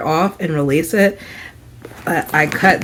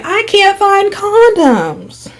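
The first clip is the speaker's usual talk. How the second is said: high, drawn-out mock wail; stretched-out, high-pitched mimicry; imitated calling out; 10 semitones higher, much slower than usual. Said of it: "trying, saying".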